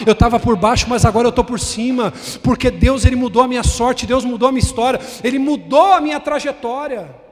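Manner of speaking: loud proclaiming testimony; testimony shout. Acted. Voicing a believer's testimony to the church, loud. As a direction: shout out the praise.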